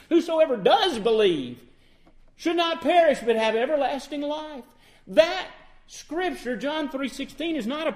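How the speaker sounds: loud, high, driving preacher's cry; fervent preaching. Acted. Preacher pressing the point on his congregation, loud and fervent. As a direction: preach it out to the back pews.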